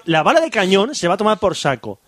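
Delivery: normal talking voice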